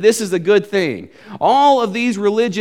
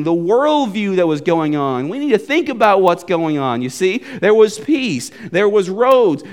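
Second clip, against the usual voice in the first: ordinary talk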